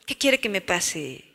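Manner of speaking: put-on moody kid mumbling back at mom; apathetic teen. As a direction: let "quiere" sag like you can't be bothered.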